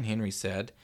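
ordinary delivery, nothing marked

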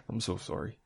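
very soft mock-Canadian apology; hushed, laughing-edged Canadian impression; Canadian accent. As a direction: echo a Canadian "sorry" under your breath. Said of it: "he's not that Canadian".

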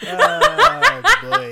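loud high laughter; laughing hard. Real, not acted. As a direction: burst out laughing. Big laugh at sex tape joke.

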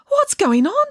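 high, loud demand; indignant boy demanding. A narrator's reading. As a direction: insist on an answer, loud and high. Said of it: "demanded Bramwellio".

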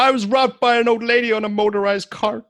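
high comic character voice; comic movie quote voice. Retold or imitated. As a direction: deliver it like a goofy movie hero. Quoting a line from Ace Ventura.

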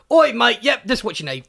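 jokey cockney impression; mock cockney. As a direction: imitate the British coworker on the radio. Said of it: "every time I hear his voice".

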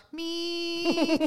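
high, drawn-out mechanical whine; imitated motor whir. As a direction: imitate the table rising with a steady hum. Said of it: "me, raising".

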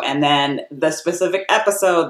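normal talking voice

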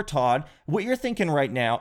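ordinary talk, nothing marked

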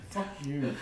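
usual speaking voice

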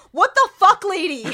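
bewildered outburst at the character; exasperated outburst. Retold or imitated. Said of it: "And I just wrote".

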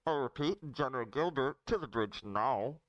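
hand-muffled intercom call. Acted. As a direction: announce it through a cupped hand. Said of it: "put my hand over my mouth".

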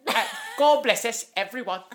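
high, loud Cockney Tiny Tim, laughing; Cockney accent. Retold or imitated. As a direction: chirp it in a child's Cockney accent. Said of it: "with my little Cockney accent".

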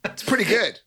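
high pleased monster voice; smug monster. Acted. GM voices creature sniffing.

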